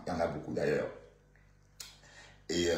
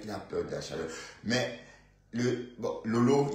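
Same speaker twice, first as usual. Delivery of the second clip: ordinary delivery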